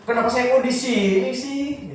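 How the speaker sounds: high, drawn-out mock whine; Ruth complaining. Preacher voicing Ruth questioning God about the posting, complaining. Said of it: "mungkin Ruth bisa aja komplain".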